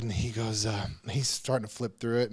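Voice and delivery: normal talking voice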